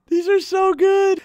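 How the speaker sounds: high, loud laughing delight; cracking up. Real, not acted. Reacting to Aussie slang comments, cracking up. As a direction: gasp it out through real laughter.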